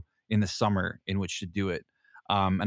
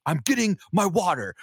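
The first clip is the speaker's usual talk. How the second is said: stubborn retort; 9 semitones higher, 4 dB louder than usual. Retold or imitated. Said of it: "And I'm like".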